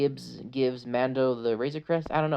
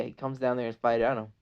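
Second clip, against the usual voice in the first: ordinary talk, nothing marked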